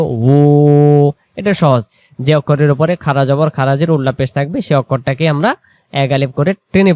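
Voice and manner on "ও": ও held long and clear; long o sound; drawn out vowel. Shown as a demonstration. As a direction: stretch ও for one alif. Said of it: "এক আলিফ টানবো".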